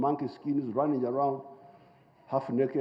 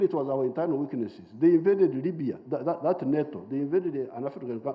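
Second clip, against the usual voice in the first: usual speaking voice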